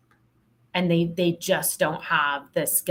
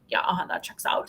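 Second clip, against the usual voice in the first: wry whisper; 4 dB quieter than usual. Retold or imitated. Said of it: "And I'm like".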